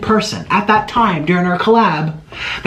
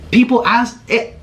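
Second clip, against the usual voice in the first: heated; 11 semitones higher than usual. Real, not acted. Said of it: "I'm getting so heated".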